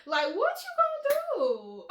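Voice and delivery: high, incredulous challenge; playful high-pitched exasperation; mock confrontation. Mock confronting the sneaky boy.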